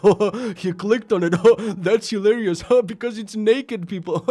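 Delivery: high, slow, laughing mock voice; mocking prankster. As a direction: mimic a smug giggling troll. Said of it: "they're gonna be like".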